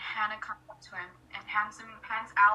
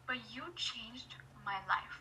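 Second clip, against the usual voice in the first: whispered. Retold or imitated. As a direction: whisper it like a secret. Retelling emotional fan moment.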